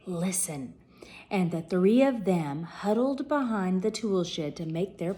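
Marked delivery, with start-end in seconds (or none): 0.1-0.7 softer hushed listen; whispered. Acted. Porky hushes the others to share the plan, softer. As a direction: hush them in close. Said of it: "Porky whispered".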